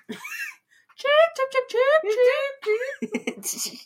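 high squeals of chip breaking into laughter; chip through laughter. Retold or imitated. Quoting line through a laugh.

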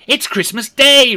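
high, loud, slow amazed delight; excited. Acted. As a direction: savour the news with joy. Scrooge marvels at the day.

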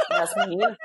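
laughing at the shopkeeper's excuse; laughing echo. Real, not acted. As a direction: repeat it through high laughter.

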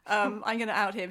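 normal talking voice

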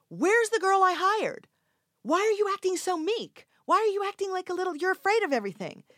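very high, loud imitated outburst; director's angry yell. Director demands the girl he hired, imitated yelling. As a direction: berate the actor at full pitch. Retelling director berating her.

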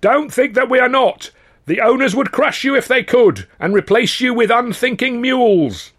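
high, loud rabble-rousing oration; fiery speech. Acted. Union orator warning dockers about the owners, loud and fiery. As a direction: whip the crowd into fury.

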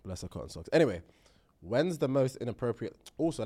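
ordinary talk, nothing marked

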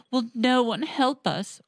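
high, tearful plea of the queen; despairing queen calling for rescue; weeping queen. Acted. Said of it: "wept the queen".

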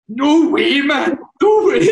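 imitating a steaming mate, pitched high; high, mock-drunk amazement; drunk disbelief. Imitating Greasy at the bar, drunkenly incredulous. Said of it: "Greasy's sitting at the bar steaming".

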